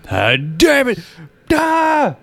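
exasperated yell, rising high; frustrated shout. Pretend. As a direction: snap and then shout out. Acted frustrated neighbour.

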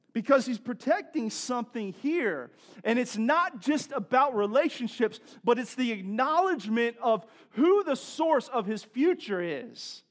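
forceful pulpit delivery with swooping emphasis; impassioned preaching. Real, not acted. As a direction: drive the point home to the congregation.

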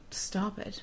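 a soft, quiet "stop it."; soft closing "stop it.". Shown as a demonstration. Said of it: "That's my advice".

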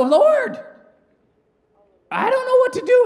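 very high acted cry to God; helpless plea. Believer looks at the mess and begs the Lord, high-pitched plea. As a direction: squeal it out as a hammed-up desperate prayer.

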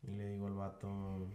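ordinary talk

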